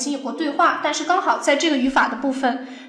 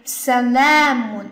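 salamun with la drawn out; stress on long LA; much slower than usual. Shown as a demonstration. Teacher reading the example, long la stressed. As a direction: lean slowly on the la in salamun. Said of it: "那么重音就在它身上".

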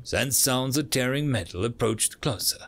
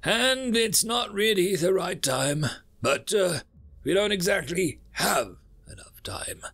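higher, hesitant character voice; awkward universe; 7 semitones higher than usual. Acted. Universe working up to an embarrassing request, awkward. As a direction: stall awkwardly, pitched up, with ums.

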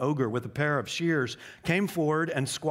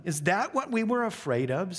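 higher sneering taunt; mocking sneer; 7 semitones higher than usual. A narrator's reading. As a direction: sneer at the fallen lion. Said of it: "said another".

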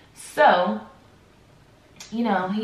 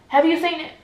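louder, raised-pitch irritated question; irritated demand; 10 semitones higher, 6 dB louder than usual. Retold or imitated. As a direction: finally burst out with the question, annoyed. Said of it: "I just get irritated, and I'm like".

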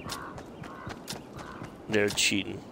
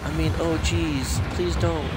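mock pleading; 7 semitones higher, slower than usual. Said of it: "He goes".

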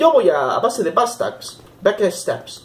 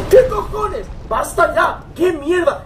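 angry shout; 11 semitones higher, slower than usual. Real, not acted. Ambushed by dragon in game.

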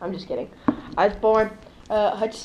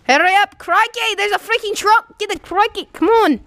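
loud, much higher hammed-up Australian crikey shouts; mock Australian accent; 10 semitones higher, 4 dB louder than usual. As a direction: yell it like a fake Aussie croc hunter. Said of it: "Why are you doing an accent?".

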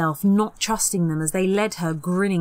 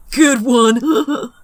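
higher, much louder laughing praise; snorting approval; 6 semitones higher, 9 dB louder than usual. Acted. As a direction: cackle at the joke. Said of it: "Peter snorted".

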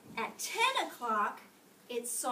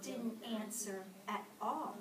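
plain reading voice

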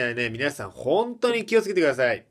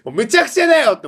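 exasperated; 9 semitones higher, 9 dB louder, faster than usual. Real, not acted.

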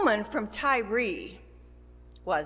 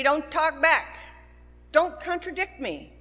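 stern mother; 5 semitones higher, 5 dB louder than usual. Retold or imitated. Mother scolding teenager, retold.

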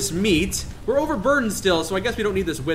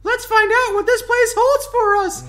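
very high, put-on translator voice; Hamideh Shah; 3 dB louder than usual. Translator joins the expedition at shore, high put-on voice. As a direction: pitch it way up for the translator. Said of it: "says Hamideh Shah".

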